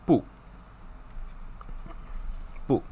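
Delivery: 不 said short, firm and falling, like a flat refusal; very hard no. Shown as a demonstration. Teacher showing students a firm 不 refusal. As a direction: clip 不 off hard and final. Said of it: "It's like a very hard no".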